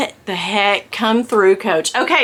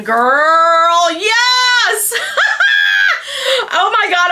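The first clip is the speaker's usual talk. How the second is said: thrilled squeal; 10 semitones higher, much slower than usual. Real, not acted. Thrilled unboxing new bag.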